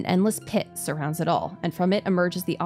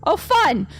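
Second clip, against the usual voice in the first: excited exclamation; 11 semitones higher, 8 dB louder than usual. Real, not acted. Sudden reaction while reading.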